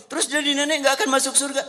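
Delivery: put-on high, fast, fretful old woman's voice; squeaky worried grandma; anxious grandmother. Grandmother pleads again.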